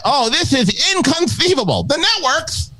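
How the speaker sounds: very high, theatrical Vizzini outrage; high, dramatic Vizzini voice; Vizzini impression. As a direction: ham up a high, cartoonish Vizzini. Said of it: "he's like".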